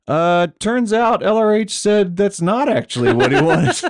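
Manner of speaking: high, loud, laughing mock announcement; laughing impression. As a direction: laugh through a faked casual claim. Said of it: "he goes".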